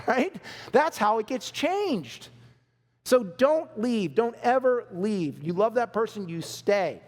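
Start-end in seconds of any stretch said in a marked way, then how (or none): 3.0-5.4 slow emphatic plea. Real, not acted. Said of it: "emphatic slow plea".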